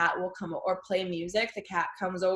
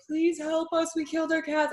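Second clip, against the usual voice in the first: higher, helpless pleading; mock pleading; 8 semitones higher than usual. Begging friends for help while tripping, high mock plea. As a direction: whine it like a lost kid.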